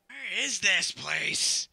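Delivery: slow, high, puzzled drawl; slow drawn-out. Pretend. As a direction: stretch every word out.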